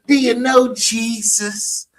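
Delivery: high, slow put-on church voice.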